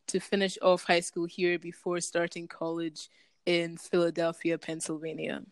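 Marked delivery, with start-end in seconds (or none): none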